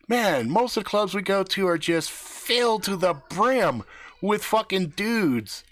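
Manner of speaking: high, slowing on the last words; high amazed complaint. Retold or imitated. Guys marvel.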